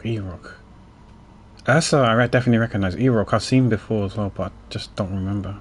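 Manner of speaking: ordinary talk, nothing marked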